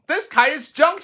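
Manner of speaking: high, whiny giving-up voice; a fed-up little toad, high and whining; despairing Toad. Acted. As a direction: whine like you've had enough. Toad repeats robins.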